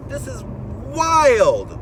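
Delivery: loud, high exclamation of disbelief; exclaiming loudly. Real, not acted. Ranting driver bursts out at how absurd work life is, loud and high. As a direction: burst out with it, incredulous.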